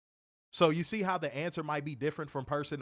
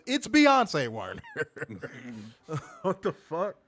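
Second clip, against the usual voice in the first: laughing exasperation; 5 dB louder, slower than usual. Real, not acted.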